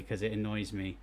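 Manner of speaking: ordinary talk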